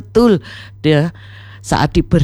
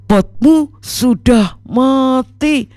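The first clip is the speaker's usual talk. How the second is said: mournful announcement; 7 semitones higher, slower than usual. A narrator's reading. Abu Nawas breaks bad news.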